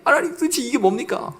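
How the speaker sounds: high, exasperated plea to God; 억울한 하소연; resentful complaint. Retold or imitated. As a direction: demand an answer, aggrieved. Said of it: "그냥 하나님한테".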